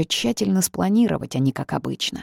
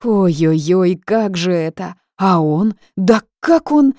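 rising outrage; 3 semitones higher, 7 dB louder, slower than usual. A narrator's reading. Heroine remembers the kiss, outrage building to a shriek. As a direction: work yourself up into fury.

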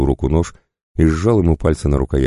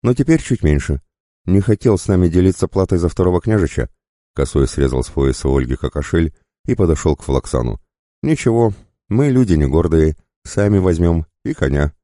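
higher, jaunty bandit voice; breezy, pitched-up gloating; smirking bandit; 4 semitones higher than usual. Acted. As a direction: make light of it mockingly. Voices the bandit mocking as he loots.

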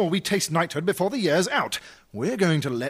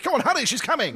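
urgent hurrying; 8 semitones higher, faster than usual. Acted. Panic as the Queen approaches.

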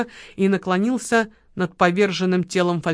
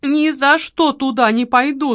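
high, slow, stubborn whine; balking refusal; 6 semitones higher than usual. A narrator's reading. Lola refuses the storeroom, balking in high voice. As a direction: dig in and refuse, voice raised. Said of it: "заортачилась Лола".

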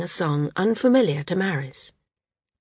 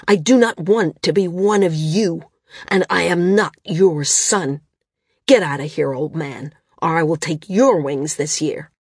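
louder, a touch of cold rage; 3 dB louder than usual. A narrator's reading. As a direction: threaten him with restrained rage. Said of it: "his face contorted in rage".

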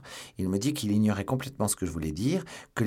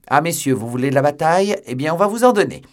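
very loud, high battle cry; shouted challenge; 8 semitones higher, 12 dB louder than usual. A narrator's reading. D'Artagnan challenges the English gentlemen to battle, shouting. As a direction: roar out a challenge to fight.

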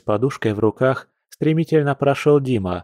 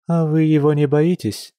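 put-on tentative, higher-pitched young guest; furtive question; 5 semitones higher than usual. A narrator's reading. Katya asks about feared man, furtive and higher. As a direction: ask cautiously, pitch held up. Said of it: "украдкой спросила Катя".

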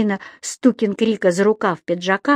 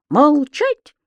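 high, abrupt order for silence; cutting him off; 7 semitones higher, 3 dB louder than usual. A narrator's reading. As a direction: interrupt with a stern command. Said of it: "оборвал его Стукин Крик".

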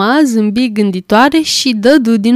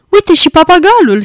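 louder, higher, thrilled cry; excited shout; 7 semitones higher, 5 dB louder than usual. A narrator's reading. Scrooge spotting the storybook parrot, excited shout. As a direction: point it out with a glad shout. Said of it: "strigă Scrooge".

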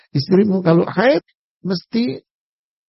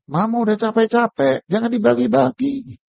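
complaining mother; 8 semitones higher than usual. Retold or imitated. Mother scolding the child.

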